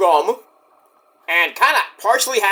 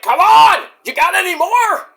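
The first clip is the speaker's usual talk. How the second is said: high, loud dare shouted across the ring; taunting yell; 11 semitones higher, 5 dB louder than usual. Retold or imitated. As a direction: bait him with a loud shout. Hook taunting Joe again.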